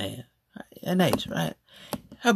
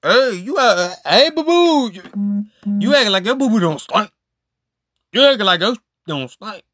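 high, loud teasing sing-song, calling her boo-boo; mock teasing; 6 semitones higher, 4 dB louder than usual. Friend teasing a changed friend, in a high loud mocking voice.

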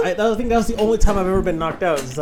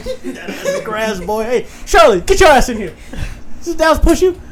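smug principal; 8 semitones higher, 5 dB louder than usual. Retold or imitated. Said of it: "acting all smug".

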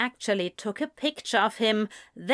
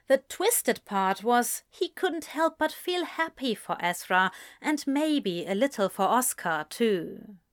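plain reading voice

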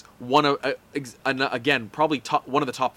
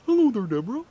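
frog voice; 7 semitones higher, faster than usual. Retold or imitated. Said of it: "maybe you can't do a Kermit either".

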